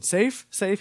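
high questioning little voice; toddler asking. Retold or imitated. Father imitating his son asking, high and questioning. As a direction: ask it like a worried toddler, rising. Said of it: "he asks it as a question".

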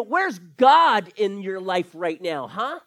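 slow, loud jeering challenge; harsh mocking accusation. Mocker taunting suffering Christians, loud jeering challenge. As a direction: taunt a believer, drawing it out. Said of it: "speak so harshly in accusations".

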